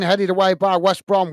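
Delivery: normal talking voice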